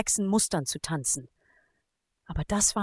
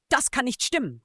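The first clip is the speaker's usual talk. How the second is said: ordinary delivery, nothing marked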